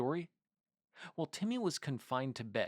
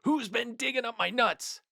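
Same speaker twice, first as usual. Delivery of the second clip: high, loud mocking shout; squirrels shouting; 10 semitones higher, 7 dB louder than usual. A narrator's reading. The crowd of squirrels chases Timmy, shouting. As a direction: yell it after him like an angry crowd. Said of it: "a crowd of squirrels shouting".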